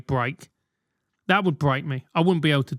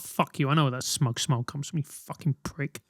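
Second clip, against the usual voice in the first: low, softer seething mutter; muttered insult; 4 semitones lower, 7 dB quieter than usual. Retold or imitated. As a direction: spit it out quietly through the teeth. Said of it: "You're like".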